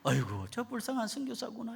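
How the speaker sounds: high-pitched, hammed-up sorrow; mock pity. Elephant weeps for the poor missionary, high mock-pitying wail. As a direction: wail in sympathy, comically high. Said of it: "선교사라고 너무 불쌍해가지고".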